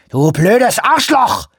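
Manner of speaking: high, slow, furious scream; screamed insult. A narrator's reading. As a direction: hurl the insult at full volume. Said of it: "schrie Shoemaker".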